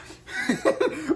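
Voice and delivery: high-pitched burst of laughter. Real, not acted. Laughing at own story.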